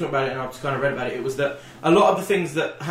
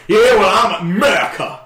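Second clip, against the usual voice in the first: loud, high mock-American accent; brash caricature of an American patriot; obnoxious American; 5 semitones higher, 8 dB louder than usual. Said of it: "obnoxious American attitude that we".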